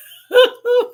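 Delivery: short, high, loud laugh; high burst of laughter. Real, not acted. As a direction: let a squeal of laughter out. Laughs at Miss Eleanor comment.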